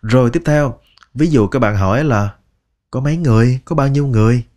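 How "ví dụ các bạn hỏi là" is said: normal talking voice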